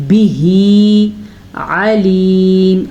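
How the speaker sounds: slow melodic tajweed chant; Quran recitation. Shown as a demonstration. Teacher demonstrates the verse for students, slow chant. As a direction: draw each word out in a chant.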